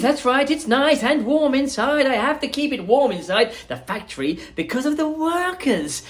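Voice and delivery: high, lively showman voice; bubbly chocolatier welcoming guests; Willy Wonka voice. Acted.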